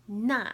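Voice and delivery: "na" short, falling sharply from high; fourth tone. Shown as a demonstration. Teacher showing the four tones, "na" falling. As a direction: drop "na" fast from the top. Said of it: "N A with the fourth tone".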